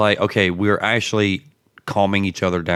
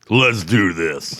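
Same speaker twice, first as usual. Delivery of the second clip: movie trailer voice; 3 dB louder, slower than usual. Acted. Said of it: "You sound like the damn movie trailer guy".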